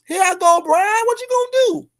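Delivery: rising high taunt. Acted.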